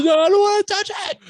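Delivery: put-on very high, loud whiny child refusing; scared kid. Eight-year-old refusing to touch the fish, loud panicked child voice. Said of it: "He goes".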